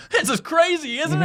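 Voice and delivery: high-pitched mock enthusiasm; imitated excitement. [name] trying to convince Nathan to enjoy the adventure, imitated excitement.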